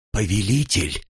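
ordinary delivery, nothing marked

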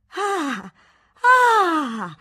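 loud high mocking laugh; burst of laughter. A narrator's reading. Spinster laughing at the tutor's pompous praise, loud mocking laugh. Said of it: "era scoppiata in una risata che non finiva più".

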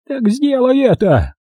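put-on high, slow, creaky old crone; creaky crow voice. A narrator's reading. Old crow egging Rodney on, high and creaky. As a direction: urge him on in a creaking hag's voice. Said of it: "Её голос был скрипучим".

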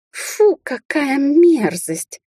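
high, drawn-out voice of disgust; disgusted girl. A narrator's reading. Sneering girl remarks on a pet snake, disgusted. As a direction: play a revolted girl, slow and high. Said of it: "послышалось со стороны скривившейся девицы".

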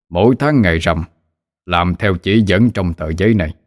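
cold otherworldly official. A narrator's reading. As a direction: speak like a distant underworld messenger. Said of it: "Người đánh ngựa đáp".

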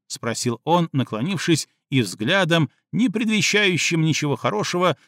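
plain reading voice

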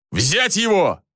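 high-pitched, imperious order; commanding roar. A narrator's reading. Officer orders soldiers to seize Jorian, imperious roar. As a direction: bark the order with authority. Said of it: "проревел властный голос".

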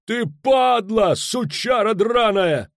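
high, loud, drawn-out cursing; furious slow-stretched bellow; outraged yell. A narrator's reading. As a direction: hurl the insult at the top of your voice. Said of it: "заорал Кобин".

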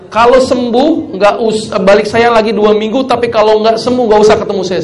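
loud emphatic doctor telling patient the deal; firm order. Retold or imitated. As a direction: lay down the terms loudly. Doctor firmly instructs patient.

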